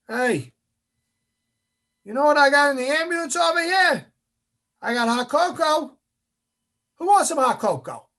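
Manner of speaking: high, loud pitchman's call; instructor's tempting shout. Retold or imitated. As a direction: call out a tempting offer across the beach. Instructor tempts trainees to quit.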